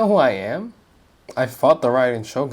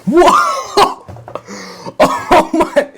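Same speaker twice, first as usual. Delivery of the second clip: high, loud shocked yelp with a laugh; real disbelief bursting out; shocked outburst; 8 dB louder, much slower than usual. Real, not acted. Streamer reacting to the villain's confession, shocked yelling.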